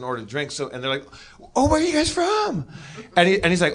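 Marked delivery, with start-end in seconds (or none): 1.5-2.7 mock a girl's high, curious squeal; excited girl. Girl at the bar asking two guys where they're from, high and gushing.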